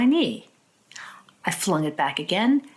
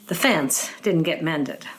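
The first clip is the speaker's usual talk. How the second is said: ordinary talk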